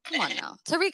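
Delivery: ordinary delivery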